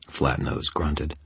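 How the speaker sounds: ordinary delivery, nothing marked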